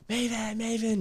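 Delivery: imitating a scream. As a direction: call the name out like a panicked girlfriend. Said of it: "my girlfriend at the time screaming".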